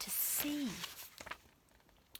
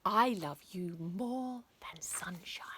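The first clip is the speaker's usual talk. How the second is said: whispered endearment; slower than usual. Acted. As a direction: breathe it to your darling.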